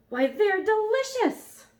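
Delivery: high, loud, slow delighted yell. Acted. Dragon yelling as he tastes the peel, high and loud. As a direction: shout it out in sudden delight. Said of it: "he jumped up yelling".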